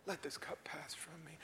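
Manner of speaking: soft whispered plea; Jesus praying in the garden; whispered prayer. Acted. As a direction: pray it under breath.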